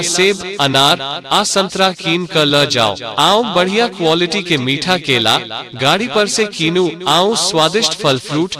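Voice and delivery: ordinary talk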